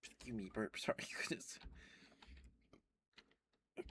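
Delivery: usual speaking voice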